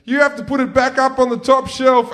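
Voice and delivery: high, loud hurried brother; high, loud mock panic from the older brother; panicked brother. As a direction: brother dumping the blame. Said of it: "drops it on the ground and goes".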